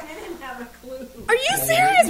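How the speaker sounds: high, slow, drawn-out disbelief; disbelieving. Retold or imitated. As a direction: stretch it out in shock. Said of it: "we were sitting there like".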